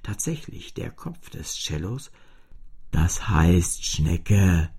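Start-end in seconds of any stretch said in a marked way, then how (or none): 2.9-4.7 tief und sehr langsam belehrend; belehrendes Cello. A narrator's reading. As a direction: wie ein geduldiger Lehrer. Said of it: "erklärte das Cello". (tiefe, langsame Erklärung)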